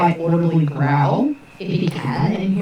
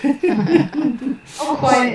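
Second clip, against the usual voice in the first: laughing, a bit higher; 9 semitones higher than usual. Real, not acted. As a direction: laugh through the words. Laughs at the British joke.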